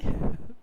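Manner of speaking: a short breathy laugh before answering; amused laugh. Acted. Old sailor laughing at the boy's question.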